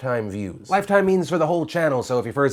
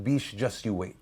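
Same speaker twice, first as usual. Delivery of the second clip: a sly, mock Russian accent, lower and softer; 5 semitones lower, 8 dB quieter than usual. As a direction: mutter it like a smug Russian. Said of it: "Is that a Russian accent?".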